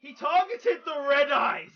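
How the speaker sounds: loud, high, drawn-out yell; outraged shout. Real, not acted. Player reacting to his Red Eyes being targeted, outraged shout. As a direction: cry it out in loud disbelief.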